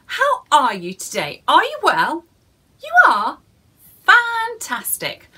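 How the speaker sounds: put-on bright, high greeting to the children; children's host welcome; cheery greeting. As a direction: greet the little ones with big cheer. Host greets children at story time.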